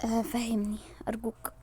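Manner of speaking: whispered desperate plea; pleading whisper. Acted. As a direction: beg him in a whisper. Pleading with him. (همس متوسل)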